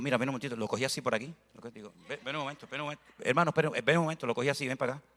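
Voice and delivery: low, soft, drawing him in close; hushed aside. Retold or imitated. Pastor pulls the offended man aside, speaking softly. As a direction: quietly call him over for a private word.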